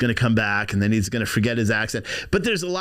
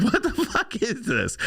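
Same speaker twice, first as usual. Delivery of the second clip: high, drawn-out incredulous question; baffled disbelief; 10 semitones higher, slower than usual. Acted. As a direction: ask it slowly, pitched up in disbelief. His baffled reaction to courtroom laughter.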